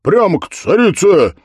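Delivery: loud, high order; triumphant blacksmith calling out; commanding shout. A narrator's reading. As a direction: fire off the destination like a command. Blacksmith commands his devil mount.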